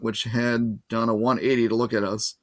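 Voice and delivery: usual speaking voice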